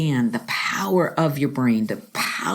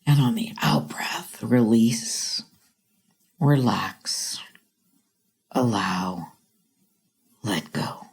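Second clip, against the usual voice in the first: low, slow, fading to a whisper; guided meditation; 5 semitones lower than usual. Shown as a demonstration. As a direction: breathe them out into stillness. Guided breathing.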